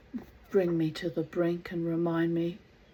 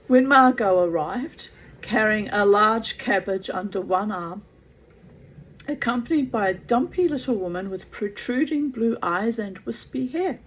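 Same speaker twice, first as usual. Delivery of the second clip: louder, animated storybook reading with swooping pitch; theatrical story narration; 5 semitones higher, 9 dB louder than usual. A narrator's reading. Gerald's account of the hotel visit, animated story narration. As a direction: read it out with dramatic flourish.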